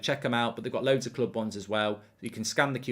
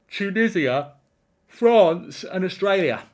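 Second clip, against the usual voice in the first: attempted Australian accent; 7 semitones higher, 7 dB louder than usual. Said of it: "it's not even an Australian accent".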